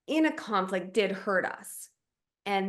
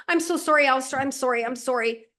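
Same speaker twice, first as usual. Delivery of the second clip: hammed-up frantic apology, higher and louder; mock frantic apology; 5 semitones higher, 3 dB louder than usual. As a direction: gush apologies to fish for comfort. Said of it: "I don't say".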